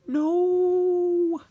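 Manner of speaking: scream. Acted. James after the crash, very high loud scream. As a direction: howl it out in terror. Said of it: "screamed James".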